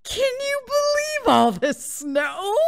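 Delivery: very high, slow, goofy voice; drawn-out high dog wonderment; amazed dog voice. Acted. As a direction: squeal it like an awestruck dog. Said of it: "sat in front of me like".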